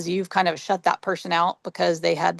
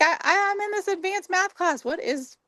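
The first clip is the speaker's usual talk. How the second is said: put-on much higher, disbelieving young student; incredulous; 12 semitones higher than usual. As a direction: sound stunned and indignant. Retells her younger self.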